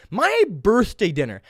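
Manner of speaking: MY BIRTHDAY DINNER drawn out, MY pitched high; indignant emphasis. Real, not acted. Indignant repeat of the occasion.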